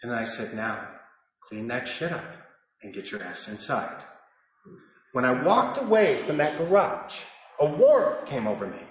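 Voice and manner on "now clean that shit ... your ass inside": low, soft, measured instruction; quiet, low fatherly order; kindly. Retold or imitated. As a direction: tell a child gently but plainly. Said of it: "with kindness, with empathy".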